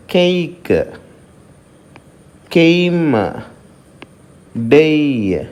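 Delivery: "కేక్, కేమ్, డేయ్" with a gliding ei vowel; diphthong demo. Shown as a demonstration. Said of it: "దాన్ని ఏ విధంగా పలకాలంటే". ("కేక్, కేమ్, డేయ్" లో ఏయ్ ధ్వనిని జారుతూ పలకండి)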